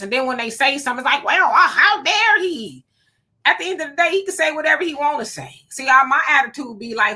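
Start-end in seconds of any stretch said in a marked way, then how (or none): 1.2-2.8 mock outrage. Mocks outraged public.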